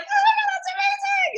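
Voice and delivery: very high, squealed group excitement; geeking out. Acted. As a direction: squeal it like thrilled friends imitated. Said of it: "geek out about it".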